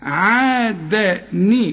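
very slow عادني with the dal given a kasra sound; joined reading. Shown as a demonstration. Teacher shows how to join the words. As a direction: stretch عادني, the tanween turning into ni. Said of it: "هنكسر الدال".

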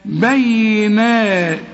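very slow بينات, the long vowel held two beats; slow tajweed demo of a two-beat madd; drawn-out recitation. Shown as a demonstration. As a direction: stretch بينات gently. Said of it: "هنمدها حركتين".

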